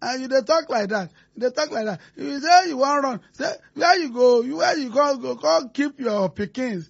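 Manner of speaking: soft, slow Nigerian Pidgin, close to tears; pleading Pidgin quote. Retold or imitated. Man retelling a plea to someone about to flee, soft slow Nigerian Pidgin. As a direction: plead slowly and quietly in Pidgin. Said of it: "kìíní kan ló ń bá ní".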